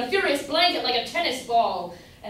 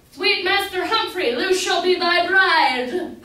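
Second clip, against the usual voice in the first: higher, louder, slow fatherly proclamation; quoting her father; 6 semitones higher, 8 dB louder, slower than usual. Retold or imitated. Humphrey imitating Luce's father blessing the match, slow and high. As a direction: announce it slowly like a proud father granting consent. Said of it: "and he hath replied".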